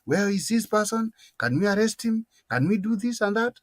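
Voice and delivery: ordinary talk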